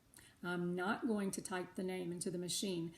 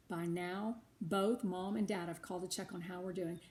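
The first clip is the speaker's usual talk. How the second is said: plain reading voice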